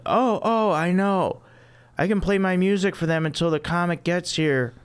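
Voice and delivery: high, flustered ditzy woman; squeaky airhead apology; ditzy Phoebe voice. Acted. Phoebe voice again.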